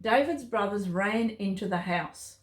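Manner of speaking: plain reading voice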